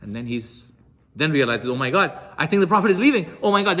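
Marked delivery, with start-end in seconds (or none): 1.7-3.8 higher, louder sudden realization; acted alarm. Sa'ad realizes the Prophet is leaving, acted alarm. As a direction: blurt it in mock panic.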